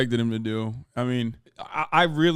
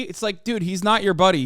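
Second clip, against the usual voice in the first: normal talking voice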